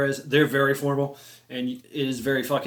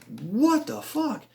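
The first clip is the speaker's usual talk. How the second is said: slow, high, drawn-out astonishment; stunned disbelief; 9 semitones higher, much slower than usual. Retold or imitated. Seeing the huge crocodile from the bridge, slow stunned disbelief. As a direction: stretch each word in disbelief.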